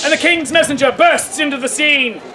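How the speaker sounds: high, dramatic proclaiming; showman's narration. Acted. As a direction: announce it to the whole crowd. Street performer narrates at full force.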